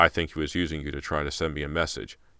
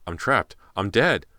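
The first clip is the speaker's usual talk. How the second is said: rising panic, louder and higher; terrified; 4 semitones higher, 5 dB louder than usual. Acted. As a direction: voice climbing into terror.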